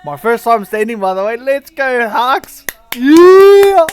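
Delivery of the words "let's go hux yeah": loud, high hype shout; excited cheering. Real, not acted. Cheering himself on the video.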